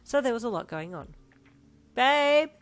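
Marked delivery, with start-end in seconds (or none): 1.9-2.5 loud call down the stairs; raised calling voice. A narrator's reading. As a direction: call up to him from far away. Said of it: "you called down the stairs".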